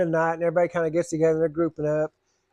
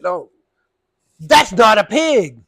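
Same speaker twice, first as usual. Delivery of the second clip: slow, much louder stern correction; angry insistence; 3 semitones higher, 9 dB louder, much slower than usual. Retold or imitated. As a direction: lay down the law. Lady angrier.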